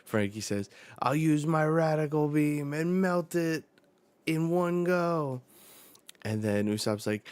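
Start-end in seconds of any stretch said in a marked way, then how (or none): 1.0-5.4 high, loud heroic boast; bold shout. Acted. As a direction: belt it out like a cartoon hero announcing an attack. Frankie boldly announces his attack.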